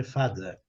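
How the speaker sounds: "Father" com th entre os dentes, fá-dher; th com língua nos dentes. Shown as a demonstration. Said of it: "A língua entre os dentes". (th de "Father" com a língua nos dentes)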